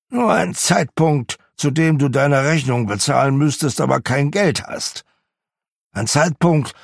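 put-on bitter old miser ranting. A narrator's reading. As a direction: pour out every grievance at Christmas. Scrooge's long angry rant.

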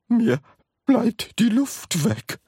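high, slow, halting gasp. A narrator's reading. Grandma short of air, gasping. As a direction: force each word out short of breath. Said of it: "keuchte Grandma Georgina".

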